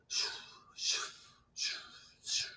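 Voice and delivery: hushed shoo shoo; soft footsteps. A narrator's reading. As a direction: breathe out each shoo softly like steps. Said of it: "They make a soft sound".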